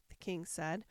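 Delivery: plain reading voice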